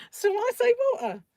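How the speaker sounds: high, loud "water" with the t dropped; cheeky child's retort. Retold or imitated. Child justifying themselves to mother, high cheeky "water" without its t. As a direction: pipe up high, saying "water" as wa'er.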